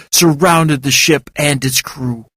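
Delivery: ordinary delivery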